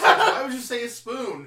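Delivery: high squeal through laughter; laughing high mock witch. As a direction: laugh out a shrill witch line. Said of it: "Then the witch says".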